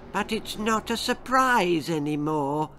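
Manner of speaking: put-on high, groaning old lady voice; disappointed old Nan. A narrator's reading. Nan groaning at Girl about her spoiled party, disappointed. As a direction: let it out as a let-down groan. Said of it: "groaned her disappointed grandmother".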